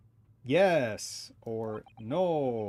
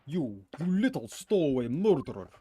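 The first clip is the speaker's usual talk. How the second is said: mock angry sailor; 5 semitones higher, 7 dB louder than usual. Voicing angry sailor in game.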